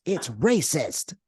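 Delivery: slow, drawn-out put-on little voice; gnome voice. Gnomes answering about garden statues, drawn-out imitated voice. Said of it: "that kind of a voice".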